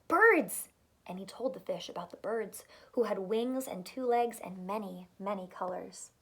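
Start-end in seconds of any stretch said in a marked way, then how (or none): 0.1-0.7 the frog's excited reveal; amazed exclaim. Acted. Frog repeats with wonder.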